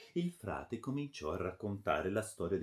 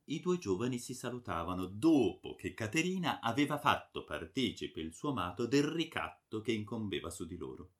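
ordinary delivery

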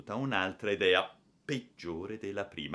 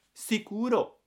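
put-on high, loud, gleeful child; happy, piping exclamation; delighted agreement; 14 semitones higher, 6 dB louder than usual. A narrator's reading. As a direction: chime in, thoroughly pleased. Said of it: "tutto contento".

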